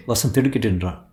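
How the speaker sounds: plain reading voice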